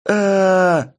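high screaming death cry; terrified scream. Acted. Scout attacked by creatures.

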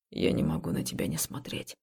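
low voiceless serious whisper. Acted. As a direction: whisper it gravely. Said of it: "Голос мужчины стал серьёзным".

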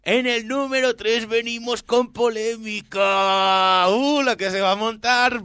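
high, hyped, drawn-out reveal; countdown announcer. Acted. Host revealing number three to listeners, hyped announcer style. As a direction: build it up like a ringside announcer.

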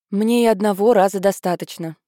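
ordinary delivery

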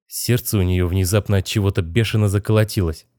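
plain reading voice

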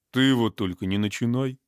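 plain reading voice

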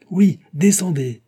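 loud, high, drawn-out challenge; furious. A narrator's reading. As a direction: shout up at them in fury. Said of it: "dit Raoul furieux".

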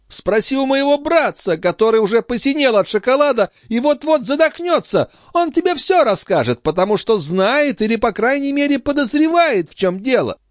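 high-pitched lady's voice, complaining about her brother; indignant countess. Acted. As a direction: play a high, exasperated countess.

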